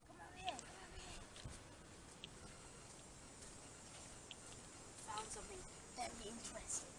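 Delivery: high, fast, soft excitement; excited whisper. Acted. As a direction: hurry over in a hushed rush.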